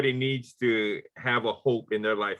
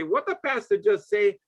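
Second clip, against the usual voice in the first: high, put-on voice of a confused listener; puzzled listener voice; 8 semitones higher than usual. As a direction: mimic someone lost by the pidgin. Listener puzzled by pidgin preaching.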